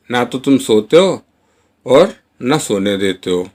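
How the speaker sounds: ordinary talk, nothing marked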